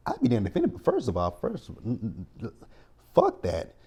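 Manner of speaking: ordinary talk, nothing marked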